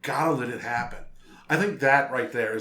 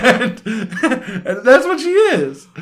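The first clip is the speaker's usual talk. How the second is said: laughing through the words, higher and louder; words spilling out in laughter; 10 semitones higher, 8 dB louder, slower than usual. Real, not acted. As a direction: crack up while saying it. Laughing at Merle story.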